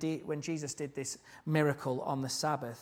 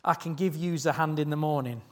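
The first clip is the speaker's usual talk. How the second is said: put-on failed Scouse accent; 8 dB louder than usual. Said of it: "can't do a Scouse accent".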